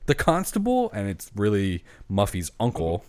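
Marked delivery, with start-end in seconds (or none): 0.1-0.9 surprised. Retold or imitated. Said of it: "And they're like".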